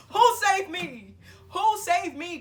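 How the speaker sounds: high, loud, drawn-out disbelief; hammed-up indignant cry of disbelief; indignant outburst. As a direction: wail it in mock outrage. Indignant retort acted out.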